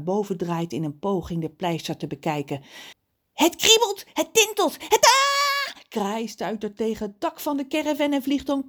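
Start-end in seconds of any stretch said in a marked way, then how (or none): 3.3-5.8 high squawks building to a shriek; rising excitement. A narrator's reading. Crow feels the plaster tingle, excitement bursting into a shriek. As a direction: build into one long loud cry.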